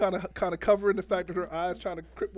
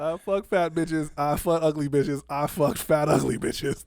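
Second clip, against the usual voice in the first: mock poetry; 4 semitones lower, slower than usual. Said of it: "That's great poetry".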